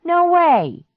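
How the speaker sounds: high, loud flat no; loud refusal. Acted. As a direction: shout it down. Clark refuses swamp walk.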